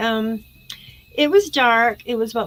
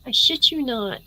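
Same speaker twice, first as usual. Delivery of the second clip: usual speaking voice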